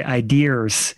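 "ideas" said eye-DEERS, R before the S; Joe's added R. Retold or imitated. Imitating Joe's slip, "ideas" as eye-DEERS. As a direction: put an R on the end of "ideas". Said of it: "throw a little R on".

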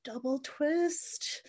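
high, slow amazed whoa; mock surprise. Watching the scene, slow high amazement. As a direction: marvel at the twist slowly. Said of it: "I'm like".

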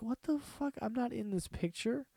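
a lightly voiced baffled guy; mock baffled stranger. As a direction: play a stranger thrown by a photo. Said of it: "and he's like".

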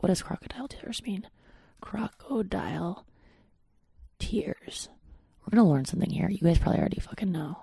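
breathy hushed whisper, voice almost gone. Real, not acted. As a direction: drop to a private whisper while checking the word. Looking up a word, hushed.

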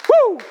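loud, high-pitched whoop; rousing whoop. Real, not acted. Preacher rousing the church, loud high whoop. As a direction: let out a big, high woo.

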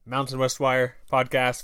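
ordinary talk

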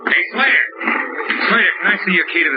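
high, sharp hail; calling out. Acted. Detective hailing the suspect to come over. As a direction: call him over from a distance.

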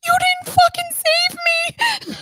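very high, slow indignant wail; drawn-out high-pitched reproach; retold outraged accusation. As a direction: squeal it in hammed-up betrayal.

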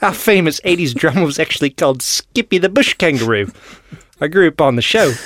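bad comic Australian accent; mock Australian accent drifting towards Irish. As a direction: read it as a hammy Aussie. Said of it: "can you do it in an Australian accent".

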